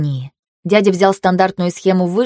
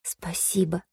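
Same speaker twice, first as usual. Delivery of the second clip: soft breathed thanks; 12 dB quieter, slower than usual. Acted. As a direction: breathe it out into his shoulder. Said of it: "выдохнула ему в плечо".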